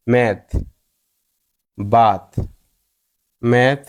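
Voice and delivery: "ম্যাথ, বাথ" ending on an aspirated t; final th like থ. Shown as a demonstration. Teacher showing word-final th, said as থ in "ম্যাথ, বাথ". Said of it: "থয়ের মতো উচ্চারিত হয়".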